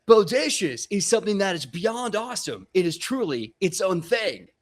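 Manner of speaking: high, loud, gushing explanation; hammed-up excited dude; enthusiastic dude. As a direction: proclaim it with big enthusiasm. [name] explains bodacious with zeal.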